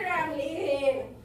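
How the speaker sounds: put-on loud, dragged-out drunken words; drunk man. Drunk announcing he is off, slow and loud. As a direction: stretch it out, super drunk.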